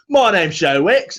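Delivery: a light cheeky Cockney intro; mock chirpy introduction; Joe Wicks impression. Partner's workout stream, light mock Cockney intro. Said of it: "every time she".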